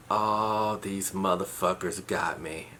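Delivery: the groan of someone who just got pranked; quiet, defeated acceptance; resigned realization. Retold or imitated. Realizing he's been pranked.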